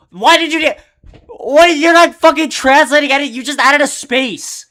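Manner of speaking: real exasperated yell at the screen; angry yelling. Real, not acted. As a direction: blow up at the useless feature, shouting. Yells at a useless translate feature.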